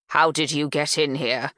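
firm, pointed question; demanding. Acted. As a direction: demand an answer sharply. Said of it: "she demands".

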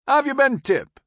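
high, loud hail; calling out. A narrator's reading. Major on shore to the corporal on the ferry, calling out. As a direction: hail an old comrade across the gap.

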